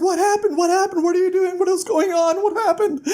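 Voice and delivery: very high frantic questions; hammed-up worried squeal; panicked. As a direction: fire off questions in mock panic. Bast runs over to hurt Kvothe.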